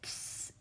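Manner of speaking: brief softened hiss; one quick, soft psst; baby's psst noise. A narrator's reading. Baby fusses and then cries, soft psst. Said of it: "It said".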